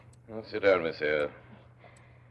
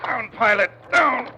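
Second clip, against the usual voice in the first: loud, high order to the dog; commanding shout; 10 dB louder, slower than usual. Acted. Master shouts loud and high at his dog. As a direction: bark the dog down.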